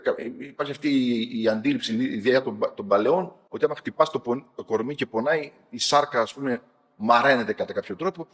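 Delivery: ordinary talk, nothing marked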